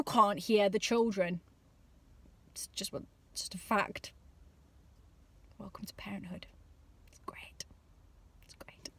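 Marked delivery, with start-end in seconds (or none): 2.5-8.9 hushed, fading sign-off; softer and softer down to nothing; whispered. Real, not acted. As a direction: whisper it like a secret to parents.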